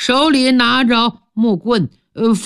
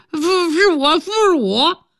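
higher, odd lisping voice with teeth missing; lightly played toothless speech; toothless mumble; 8 semitones higher than usual. A narrator's reading. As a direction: talk like your front teeth are gone. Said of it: "说话嘴都不兜风了".

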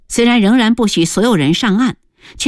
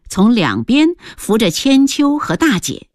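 plain reading voice

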